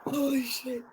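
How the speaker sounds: crying with laughter; can barely talk for laughing; laughing hard. Real, not acted. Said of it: "I'm crying".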